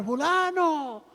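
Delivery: high, drawn-out name call; mimicked long-distance holler without real force; imitated calling out. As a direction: call from the street in a high stretched voice. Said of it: "empezaba a gritar".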